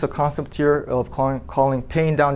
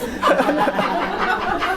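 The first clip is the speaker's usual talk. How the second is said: very high, giggly burst of laughter; squealing laugh. Real, not acted. Confessing the friend to the class, high giggling laugh. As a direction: crack up mid-confession.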